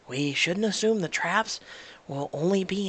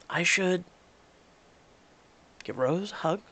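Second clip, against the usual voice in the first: ordinary talk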